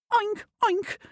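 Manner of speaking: high squealy oink oink. Acted. The horse oinking at the animals, high. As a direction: oink like a piglet. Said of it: "said the horse".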